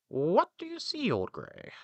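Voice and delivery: high, loud little rooster calling up. A narrator's reading. Rooster calls up to the donkey, high character voice. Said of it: "the rooster asked".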